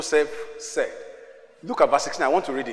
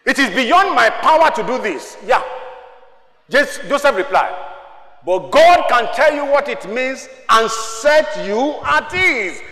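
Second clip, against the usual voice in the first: raised, emphatic pulpit delivery; ringing declarations with strong punches; preaching at full force; 8 semitones higher, 8 dB louder than usual. Real, not acted. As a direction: proclaim it to the whole church.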